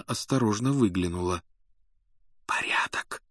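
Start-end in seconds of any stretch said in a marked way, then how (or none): 2.5-3.2 unvoiced hushed whisper. A narrator's reading. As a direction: breathe it without voice. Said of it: "шепнула она".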